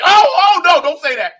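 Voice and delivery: high, loud oh oh of alarm; mock-horrified yelp to stop them; alarmed mock shout. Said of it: "And she goes like".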